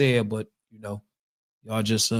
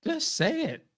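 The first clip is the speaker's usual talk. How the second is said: high mimicking; 10 semitones higher than usual. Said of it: "he said".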